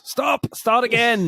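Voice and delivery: very high-pitched mock outburst; hammed-up high yell; imitated sudden shout. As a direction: cry it out like an exasperated director halting a show. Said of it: "he just shouts".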